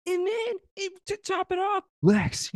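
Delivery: usual speaking voice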